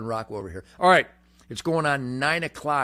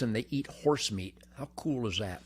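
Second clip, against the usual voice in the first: whisper; 5 semitones lower, 7 dB quieter than usual. Real, not acted. Whispered aside about horse meat.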